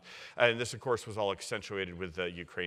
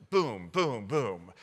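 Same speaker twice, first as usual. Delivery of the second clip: punchy mouth-made booms, louder; explosion sounds; 4 dB louder, much slower than usual. Retold or imitated. Historian tells how the Siberian pipeline blew up, mock booms. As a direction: mimic the blasts with a round boom each.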